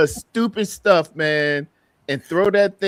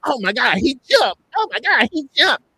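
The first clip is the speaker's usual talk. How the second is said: higher, amazed fan voices mimicked; mock excited fans; 8 semitones higher than usual. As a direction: gush like a hyped fan. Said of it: "people were like".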